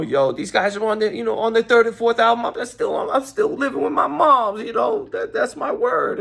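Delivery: high, whiny put-on rapper voice; comic rapper impression, high and griping; [name]. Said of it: "[name]".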